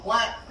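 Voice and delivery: one sharp quack on the call; duck call quack. Shown as a demonstration. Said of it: "We've all heard duck quack".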